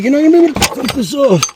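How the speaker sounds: mock high-pitched warning to her; high mock quote. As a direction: squeak it out teasingly. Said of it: "I'm like".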